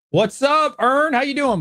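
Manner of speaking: excited shout-out to Ern; excited greeting. Real, not acted. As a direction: call out a happy hello. Greeting a viewer by name.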